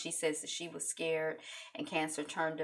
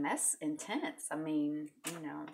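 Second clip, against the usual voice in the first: normal talking voice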